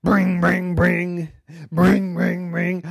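phone ring. Acted. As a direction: ring out like an old telephone. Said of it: "pick up the phone".

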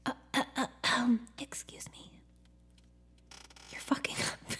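soft whispered interruption; universe's gentle whispered nudge; polite whispered tap. Pretend. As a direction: tap their shoulder with a hushed excuse me. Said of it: "a gentle tap on your shoulder".

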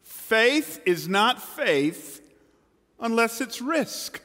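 very slow, spaced out; slow and deliberate, rising; slow emphasis. Shown as a demonstration. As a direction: lay each word down slowly. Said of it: "repeated slowly for emphasis".

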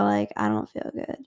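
faint, unvoiced complaint of feeling ill; mock sick whisper. Telling the guy she feels sick, whispered. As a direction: fake being unwell, barely voiced.

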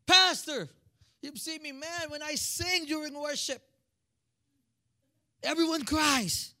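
lightly voiced high-pitched self-admirer; boastful worship singer. Church singer tells pastor about singing during worship, boastful.

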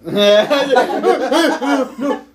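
long burst of laughter; sustained laughing. Real, not acted. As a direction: laugh helplessly at the game. Laughing during shock game.

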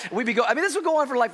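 usual speaking voice

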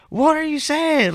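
yelling. Retold or imitated. Teen yelling from his bedroom to his dad, high and slow. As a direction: yell it across the house at your dad. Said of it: "I'm yelling from my room".

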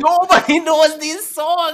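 high, loud laughing tease. Real, not acted. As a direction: laugh through the jab. Laughing teasing over singer's songs.

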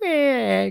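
high whiny meh; mock whine. Telling how Brian kept complaining in the boat, mock whine. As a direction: imitate a buddy's whining. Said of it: "he just kept".

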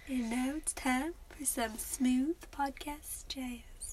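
slow, whispered late-night DJ voice; smooth jazz radio host. Pretend. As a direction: breathe it out like a smooth jazz announcer. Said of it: "Did you turn into a country singer?".

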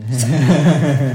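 sudden real laughter; loud burst of laughing. Real, not acted. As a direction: crack up loudly. Laughs at self-insult joke.

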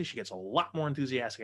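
usual speaking voice

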